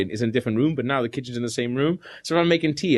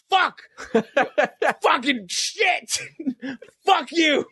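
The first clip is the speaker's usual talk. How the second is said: mock yell; 11 semitones higher, much slower than usual. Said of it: "you've been shouting for the last twenty minutes".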